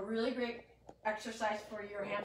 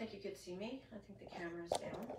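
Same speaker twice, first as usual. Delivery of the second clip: hushed aside off camera; whispering; 2 semitones lower, 8 dB quieter, faster than usual. Real, not acted. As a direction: whisper it to someone nearby.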